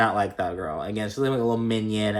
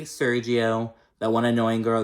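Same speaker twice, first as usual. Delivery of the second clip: ordinary delivery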